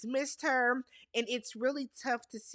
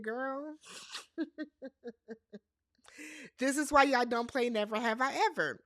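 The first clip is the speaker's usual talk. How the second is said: laughing. Retold or imitated. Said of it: "And I said".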